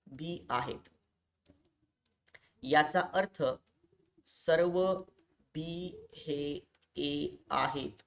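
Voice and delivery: very slow, with long pauses between words; slow, deliberate. Real, not acted. Teacher gives students the most important logic rule, slowly and deliberately. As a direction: spell the rule out piece by piece, low and measured.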